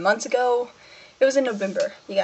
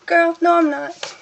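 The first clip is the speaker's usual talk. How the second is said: protesting; 7 semitones higher, 4 dB louder, slower than usual. Retold or imitated. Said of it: "I'm like".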